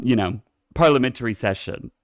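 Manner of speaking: ordinary talk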